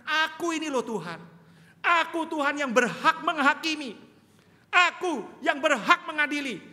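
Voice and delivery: loud, high rebuke from the pulpit; full-force preaching. Acted. Pastor rebuking hypocrites, loud and high. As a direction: thunder the warning at hypocrites.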